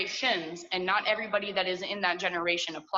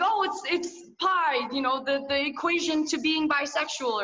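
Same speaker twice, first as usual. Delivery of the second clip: hammed-up silly reply; mock clueless voice; 6 semitones higher than usual. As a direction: play the clueless kid, pitched up. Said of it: "I was like".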